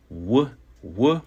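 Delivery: "w, w" said as isolated English W sounds. Shown as a demonstration. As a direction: sound out "w, w" as a short, demonstrated glide. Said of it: "pronounced just as it is in English".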